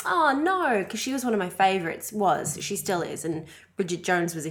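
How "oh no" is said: high, dismayed gasp of words. Retold or imitated. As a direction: sound let down and shocked. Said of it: "I was like".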